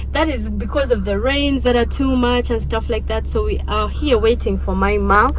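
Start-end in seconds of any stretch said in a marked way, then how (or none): none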